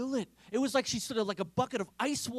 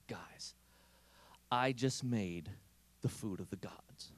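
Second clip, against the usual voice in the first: hushed secret; 10 semitones lower, 8 dB quieter than usual. Retold or imitated. Waitress confiding a secret.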